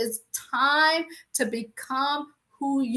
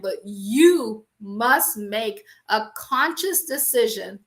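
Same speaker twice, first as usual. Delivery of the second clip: slow emphatic; 2 semitones lower, slower than usual. Shown as a demonstration. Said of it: "say that one more time".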